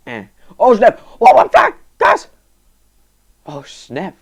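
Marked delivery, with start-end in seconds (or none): 0.6-2.3 high, loud shocked yelp; startled yell. Real, not acted. Gamer yelping at a surprise in the game.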